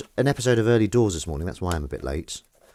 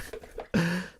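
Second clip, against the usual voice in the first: laughing at her own joke; high giggle; 10 semitones higher, 6 dB quieter than usual. Real, not acted.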